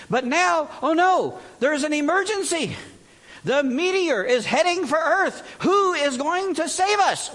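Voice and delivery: high, loud, slow hammed-up alarm; mock panic. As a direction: play the frightened crowd over the top. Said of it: "normal tone of voice".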